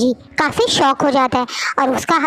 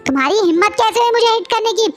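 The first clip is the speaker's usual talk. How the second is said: high, furious rant, sometimes rushing; high, outraged scolding after being hit; angry tirade; 6 semitones higher than usual. A narrator's reading. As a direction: tear into him, pitched up high.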